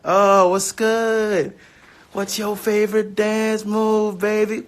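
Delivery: slow, high, smooth-talker croon; flirty put-on voice. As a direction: ask it like a cheesy lover, drawn out. Playful flirty repeat of question.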